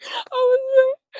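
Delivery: high, loud laugh breaking into a cry; half laughing, half crying. Real, not acted. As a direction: laugh through real distress. Laughing while recounting distress.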